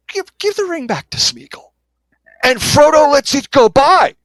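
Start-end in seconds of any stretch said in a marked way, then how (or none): 0.1-1.7 high, pleading Gollum voice; Gollum plea. Retold or imitated. Host quoting Gollum begging for the ring, high pleading voice. Gollum quoted in book talk.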